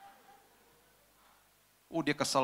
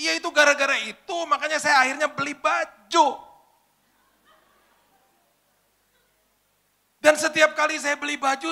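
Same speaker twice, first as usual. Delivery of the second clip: tearful venting; 11 semitones higher, 7 dB louder than usual. Retold or imitated. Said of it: "Sambil nangis-nangis".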